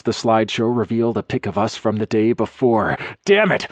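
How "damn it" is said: sharp high shout; bursting out with a loud cry. A narrator's reading. Dax cursing at the gossip photos, shouting. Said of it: "I shouted".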